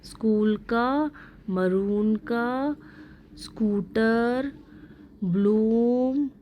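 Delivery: slow listing of स्कूल, मरून, स्कूटर, ब्लूम, long vowels. Shown as a demonstration. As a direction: slowly list स्कूल, मरून, स्कूटर, ब्लूम with each oo stretched. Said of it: "long sound किस किस का है".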